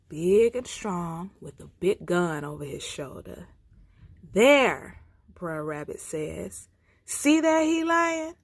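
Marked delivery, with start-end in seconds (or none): 4.3-8.4 excited pointing. Acted. Rabbit points out Man.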